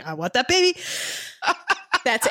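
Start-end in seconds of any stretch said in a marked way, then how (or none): 0.0-0.8 bad movie-star impression, higher and rising at the end; Robert De Niro. Co-host hearing the scene's line in a bad De Niro voice. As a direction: do a hokey impression of the actor, lifted in pitch. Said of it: "the bad Robert De Niro voice going".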